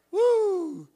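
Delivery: mock frightened cry; startled woo. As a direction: jump back with a high "woo". Reacting to the roar.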